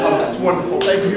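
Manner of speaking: ordinary delivery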